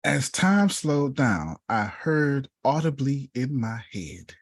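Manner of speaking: very slow, stretched out; slowed-down dramatic telling; drawn out. Real, not acted. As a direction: let the words hang as time slows. Dramatic storytelling moment.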